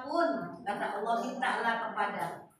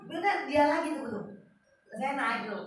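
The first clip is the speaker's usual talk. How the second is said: usual speaking voice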